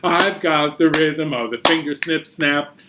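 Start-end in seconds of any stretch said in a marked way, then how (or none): none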